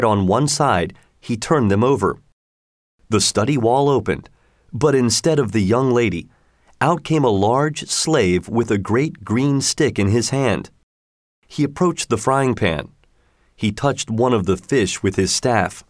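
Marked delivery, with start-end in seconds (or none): none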